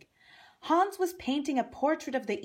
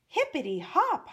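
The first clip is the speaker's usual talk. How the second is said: excited exclaim; 7 semitones higher, 3 dB louder than usual. Acted. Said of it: "Hoppy exclaimed".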